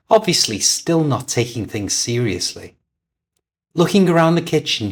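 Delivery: ordinary delivery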